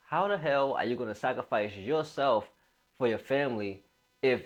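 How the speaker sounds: usual speaking voice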